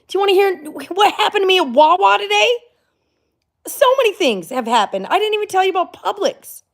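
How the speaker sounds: high, loud, eager gush; bursting to spill the news; excited. Real, not acted. Vlogger teasing her viewers with a high excited gush.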